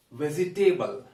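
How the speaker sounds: 'vegetable' in four syllables, ending like 'table'; common mispronunciation. Shown as a demonstration. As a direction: say 'vegetable' as 've-zi-TAY-bul'. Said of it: "Some people pronounce it".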